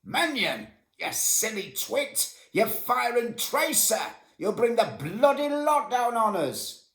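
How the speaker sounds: high, loud, drawn-out bellow; sergeant's shout. Retold or imitated. Sergeant bawling out a soldier for firing tracer, furious shout. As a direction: roar an angry warning at a soldier. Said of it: "shouted out".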